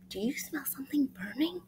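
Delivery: soft worried whisper. Acted. Monkey asking siblings about a smell, worried whisper. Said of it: "One little monkey says".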